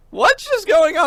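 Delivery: retold confusion at the alarm; confused alarm. Hearing the fire drill, high startled confusion. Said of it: "and I'm like".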